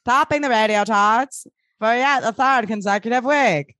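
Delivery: put-on vintage radio host voice; sixties radio announcer. Host introducing a hit song, radio announcer voice. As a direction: announce it like a sixties chart show. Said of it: "radio announcer voice is".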